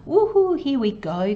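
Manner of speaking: gleeful whoop settling back down; very excited. Shown as a demonstration. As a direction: let out a high woohoo, demonstrating the buzz. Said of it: "very excited, very open".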